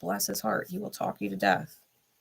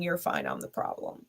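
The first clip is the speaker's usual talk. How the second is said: mock sarcastic; 8 dB quieter than usual. Mocking a partner's sarcastic line.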